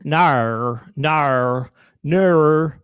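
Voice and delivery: "no" with a long, bent Australian vowel; exaggerated Australian twang on "no". Shown as a demonstration. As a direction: stretch "no" into an Australian diphthong. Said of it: "it sounds like this".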